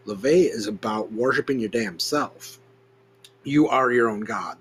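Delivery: ordinary talk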